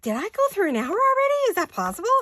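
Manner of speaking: high, loud, fast burst of playful alarm; panicked. Retold or imitated. Crafter telling viewers she feared time was up, comic panic. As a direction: re-enact a flustered panic at the top of your voice. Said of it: "Did you hear the panic in my voice?".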